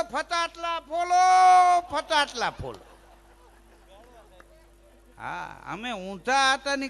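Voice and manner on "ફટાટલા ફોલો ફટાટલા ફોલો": high shouted ફટાટલા follow with a lisp. Retold or imitated. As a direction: bark the order through a lisp. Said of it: "મગનાનો બાપ ઊભો થઈ ગયો".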